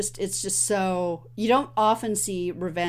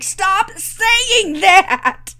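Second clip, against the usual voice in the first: exasperated shout; 11 semitones higher, 10 dB louder, much slower than usual. Retold or imitated. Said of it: "and he's like".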